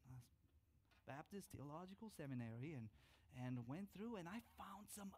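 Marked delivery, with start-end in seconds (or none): none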